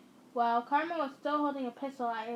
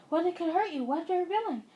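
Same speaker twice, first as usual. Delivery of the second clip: raised panicky voice, louder and higher; mock yelling; 5 semitones higher, 4 dB louder, faster than usual. Parents yelling at their kid in a mock panic. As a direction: parents fretting loudly at once. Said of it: "they started yelling".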